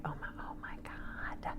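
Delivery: breathy whisper, barely voiced; stunned whispered oh my God; retold horrified whisper. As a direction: hush it out like quiet dread. Horrified reaction, retold inner voice.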